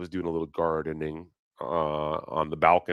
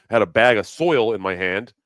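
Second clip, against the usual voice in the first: usual speaking voice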